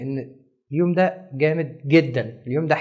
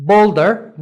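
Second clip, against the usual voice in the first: "bolder" said louder, BOLD leaned on; bolder stressed; 4 semitones higher, 7 dB louder than usual. Shown as a demonstration. Teacher explaining stress, saying "bolder" loudly. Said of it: "bolder يعني الأوضح والأقوى".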